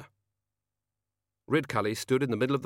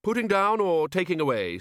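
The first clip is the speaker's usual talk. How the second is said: put-on higher, brisk old wizard; bluff wizard; 9 semitones higher, 4 dB louder than usual. A narrator's reading. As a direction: ask it briskly. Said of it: "said Ridcully".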